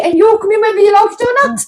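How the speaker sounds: playful bossiness. Real, not acted. Host bossing friend playfully.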